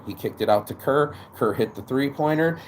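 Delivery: normal talking voice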